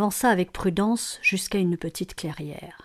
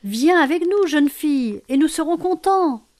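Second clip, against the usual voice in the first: high, loud joyful invitation; cries of joy; 8 semitones higher, 6 dB louder than usual. A narrator's reading. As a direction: call her over gleefully. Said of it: "par des cris de joie". (cris de joie aigus)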